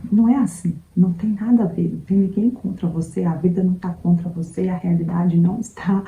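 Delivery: ordinary talk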